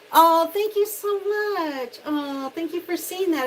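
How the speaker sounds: high, loud, slow delighted thanks; gushing thanks. Real, not acted. Host thanking a viewer for a kind comment, gushing. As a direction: pour out delighted gratitude to a viewer.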